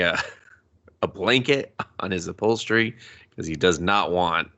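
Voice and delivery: ordinary delivery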